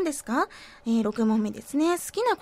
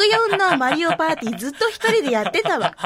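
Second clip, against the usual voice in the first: indignant retort; 2 semitones higher, 6 dB louder than usual. Real, not acted.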